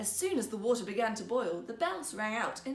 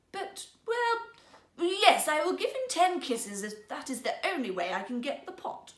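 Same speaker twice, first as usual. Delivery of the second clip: hesitant princess; 5 semitones higher, 5 dB louder than usual. Acted. Princess gives in.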